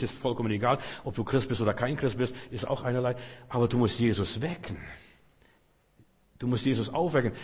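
ordinary talk

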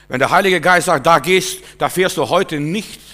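loud, high, emphatic preaching; loud preacher voicing the Holy Spirit's warning; forceful preaching. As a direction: proclaim it loudly to the congregation.

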